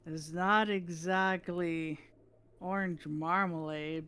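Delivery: slow, drawn-out mock grandeur; slow dopey verdict. Pops judging his apple juice, slow and dopey. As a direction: linger over each word groggily.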